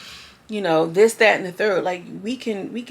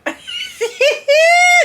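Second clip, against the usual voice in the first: high, loud shriek of laughter; 7 dB louder than usual. Real, not acted. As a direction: burst into a screaming laugh. Vlogger cracks up.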